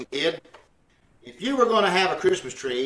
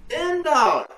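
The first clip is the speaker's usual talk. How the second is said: outraged haggling; 7 semitones higher than usual. Acted. Haggling outrage over tree price.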